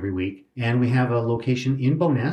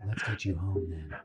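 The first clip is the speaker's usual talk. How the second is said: softer, gently eased-in playful line; mock-tender soft onset voice; 3 semitones lower, 7 dB quieter than usual. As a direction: ease into each word softly, teasing. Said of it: "now I've got my soft onset voice on".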